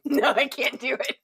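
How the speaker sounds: laughing too hard to do the calm voice. Real, not acted. Streamer fails to do calm tutorial voice, laughing. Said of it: "let me see if I can do tutorial voice".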